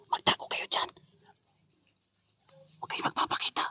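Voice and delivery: urgent whisper; whispering while hiding. Real, not acted. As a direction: hiss it low so they don't hear. Hiding from the man.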